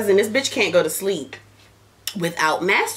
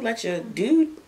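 ordinary delivery

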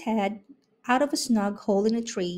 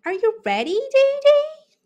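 very high, loud call to a friend; very high, much louder bird calling; 8 dB louder than usual. A narrator's reading. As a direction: shout up high to Dee Dee. Bird calls to Dee Dee.

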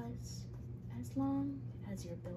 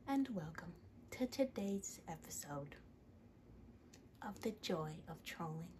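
soft, hushed, gentle TV painter; soft painting show host; 4 dB quieter than usual. Acted. A painting host soothing viewers, soft and hushed.

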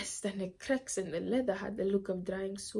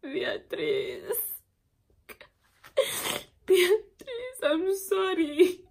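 high, broken crying voice; sobbing apology; 11 semitones higher, 5 dB louder than usual. Acted. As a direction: weep through an apology to your baby. Weeping apology to her dead baby.

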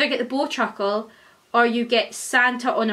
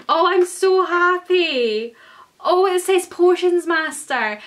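higher, louder, slow delighted gush; overjoyed squeal; thrilled; 6 semitones higher, 5 dB louder, slower than usual. Real, not acted. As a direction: stretch it out, bursting with happiness. Thrilled unboxing the pin.

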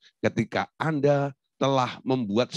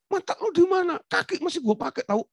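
high-pitched indignant bark; imitated Batak outburst. Batak man whose foot got stepped on, mock yelling. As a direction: act out an instant angry outburst, pitched high. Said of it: "kalau orang Batak langsung bilang".

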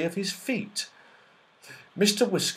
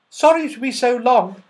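high, loud elf voice; chirpy little elf apologising; 13 semitones higher, 10 dB louder than usual. Acted. Elf apologising to the children, high loud elf voice.

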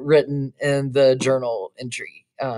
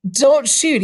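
higher, louder, controlled nervous plea; 9 semitones higher, 6 dB louder than usual. Acted. Young man facing a drawn gun, nervous plea. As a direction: hold back the fear as you beg. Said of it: "trying to control his nervousness".

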